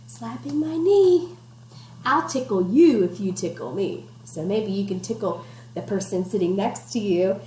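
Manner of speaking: ordinary talk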